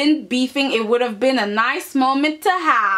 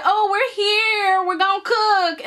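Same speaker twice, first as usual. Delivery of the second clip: mock Logan girls; 8 semitones higher than usual. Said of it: "the Logan girls come in and they're like".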